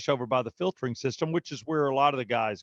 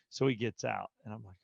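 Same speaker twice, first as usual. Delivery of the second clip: normal talking voice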